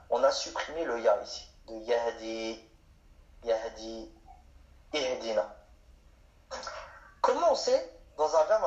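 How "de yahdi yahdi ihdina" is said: yahdi said slowly and clearly, each sound apart; slow model word. Shown as a demonstration. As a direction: spell out yahdi slowly for learners. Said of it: "teacher models root verb".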